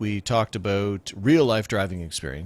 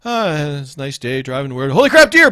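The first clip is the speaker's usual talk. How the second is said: breezy then a much louder, higher shriek; hubcap's panic; 4 semitones higher, 9 dB louder than usual. Pretend. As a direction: cruise along then panic at the deer. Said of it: "the hubcap's sitting there going".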